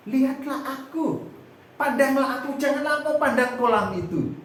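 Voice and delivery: Jesus raising his voice to the lame man, urging; Jesus calling out. Acted. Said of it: "Tapi Tuhan bilang".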